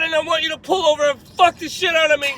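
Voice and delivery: high, very loud hysterical demand, imitated; hammed-up screamed demand; mock screaming. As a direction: yell the order like a distraught girl. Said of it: "yelling and screaming at me".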